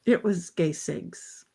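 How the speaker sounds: muttered echo. Retold or imitated. Echoing the quote, quietly.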